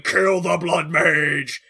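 slow, grim spirit voice; Vengeance spirit. Retold or imitated. Imitating Vengeance demanding a kill, slow and grim. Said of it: "you've got Vengeance going".

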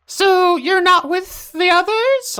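shrill head voice. Acted. Head asks.

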